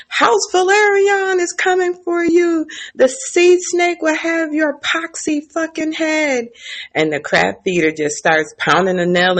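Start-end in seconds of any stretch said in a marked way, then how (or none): 0.1-6.5 mock screaming captive. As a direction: voice the chained man in a high cry. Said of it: "someone screaming".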